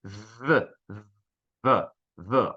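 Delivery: buzzing "v", each one separate; low, held "v" with a short release; voiced V sound. Shown as a demonstration. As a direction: make the "v" vibrate in the throat. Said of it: "Agora, se eu faço v".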